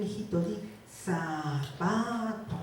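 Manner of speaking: ZAPATO drawn out slowly, one syllable at a time. Shown as a demonstration. Mother teaching her little son a word, syllable by syllable. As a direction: stretch "zapato" into sa, pa, to for a toddler. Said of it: "la mamá está dividiendo en sílabas".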